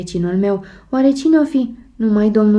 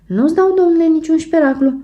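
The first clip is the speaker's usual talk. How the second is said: louder and much higher, refusing outright; indignant refusal; 8 semitones higher, 3 dB louder, faster than usual. Acted. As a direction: shoot it down, Gigi won't give up the key. Gigi refuses the master key. (refuz indignat, mai tare și mult mai sus)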